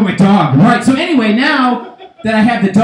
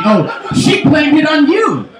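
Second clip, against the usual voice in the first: high, drawn-out exclamation; mock outrage; 7 semitones higher, slower than usual. Comic reacting to the blame, high mock outrage. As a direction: stretch it out in high-pitched disbelief.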